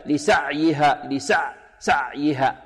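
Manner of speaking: slow لسعيها with the ع choked off short; over-tight ayn. Shown as a demonstration. Teacher imitating students' mistake, لسعيها with a clamped ayn. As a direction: stop the sound hard on the ع of لسعيها. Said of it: "قريب من الشدة، يقول هكذا".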